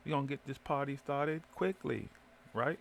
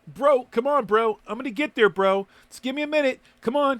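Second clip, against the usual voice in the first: high, loud, exasperated rant; riled up and shouting; worked up, loud; 11 semitones higher, 10 dB louder than usual. Real, not acted.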